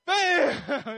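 high, hammed-up yelled bang; shouted impact. Pastor playfully copying Moses striking the rock, shouted impact. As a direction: yell it, about to crack up.

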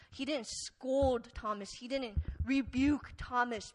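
ordinary talk

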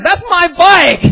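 loud, slow, high acted yell; outraged owner. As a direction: bellow your claim, stretching the words. Bike owner yells at him.

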